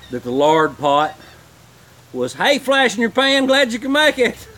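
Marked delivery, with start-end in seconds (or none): 2.3-4.5 cheery greeting in a much higher, louder voice; playful high voice. Acted. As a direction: greet it like an old buddy, high and bright. Fire under lard pot.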